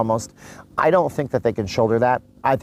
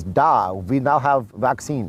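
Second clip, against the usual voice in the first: slow, hammed-up Russian accent; terrible Russian accent; slower than usual. Vlogger mimics Putin announcing a vaccine, terrible Russian accent. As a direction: do a bad Russian accent, drawn out. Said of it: "That's a terrible Russian accent".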